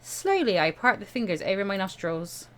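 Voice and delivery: ordinary talk, nothing marked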